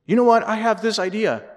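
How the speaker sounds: loud, high burst of sudden inspiration; credit-stealing boss. Acted. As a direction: announce it as your own brainwave. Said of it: "a boss that takes all the good ideas".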